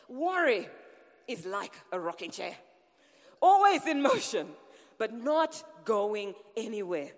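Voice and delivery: a light take on a famous woman preacher, slowing at the end; Joyce Meyer impersonation. Shown as a demonstration. Pastor impersonates a famous Bible teacher for the church, lightly hammed. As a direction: mimic a celebrity Bible teacher with a loud burst on always in motion. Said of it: "Should I do my voice, uh, Joyce impersonation".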